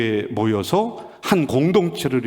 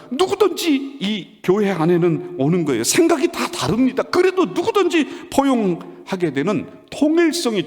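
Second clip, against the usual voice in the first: high, insistent sermon delivery; emphatic preacher in full flow; impassioned preaching; 9 semitones higher, 4 dB louder than usual. Real, not acted. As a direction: press the point home from the pulpit, pitched high.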